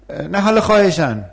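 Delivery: high begging plea for a grade; pleading student. Acted. (دانشجوی ملتمس)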